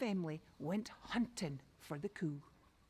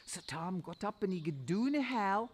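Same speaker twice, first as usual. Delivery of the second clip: plain reading voice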